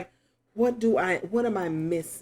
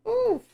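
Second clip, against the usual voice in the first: high oof. Real, not acted. Reacting to the reading.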